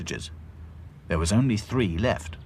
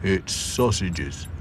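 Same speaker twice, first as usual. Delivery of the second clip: Sad Sack; much slower than usual. A narrator's reading. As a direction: state it plainly and slowly. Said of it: "said Sad Sack".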